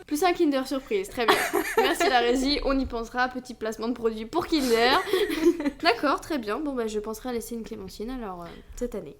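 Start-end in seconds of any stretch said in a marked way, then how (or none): 0.1-5.0 en riant. Real, not acted. Rit de la blague Kinder. 5.0-5.8 petit rire plus haut et plus fort; rire franc. Real, not acted. Rire après la blague.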